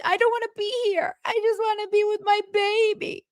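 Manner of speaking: high, far-off whiny imitation; mock her pining whine; whiny mimic. Podcaster mimicking Meredith pining for her kids, high whine. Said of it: "And then she's".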